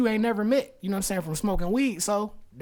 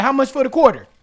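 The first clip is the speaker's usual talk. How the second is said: louder, higher blunt ask; loud question; 7 semitones higher, 10 dB louder than usual. Retold or imitated. Narrator's loud question in story.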